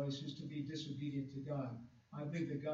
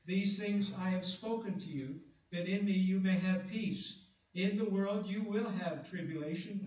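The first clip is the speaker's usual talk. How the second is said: scripture reading; 2 semitones higher, 4 dB louder than usual. Acted.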